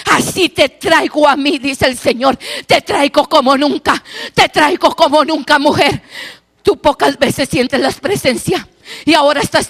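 loud fervent prophecy; full-force preaching. Acted. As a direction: proclaim the presence over her.

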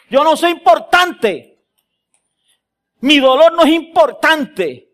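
loud, high fiery preaching. Real, not acted. Pastor preaching loudly to the congregation, fervent. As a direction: thunder it from the pulpit.

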